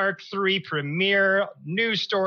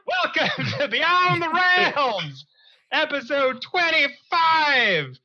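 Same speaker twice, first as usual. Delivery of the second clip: slow, high, showy show intro; hammed-up announcer voice; 8 semitones higher, slower than usual. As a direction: announce the episode slowly.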